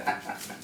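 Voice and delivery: quiet run of chuckles; short chuckle. Real, not acted. Grower having made up their mind about the box, short chuckle. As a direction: laugh softly at yourself.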